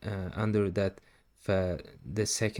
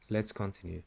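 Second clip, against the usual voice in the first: ordinary talk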